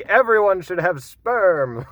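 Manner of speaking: high, loud silly voice; mock quote. Vlogger mocking a typo in the transcript, silly high voice. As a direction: blurt it like a ridiculous slogan.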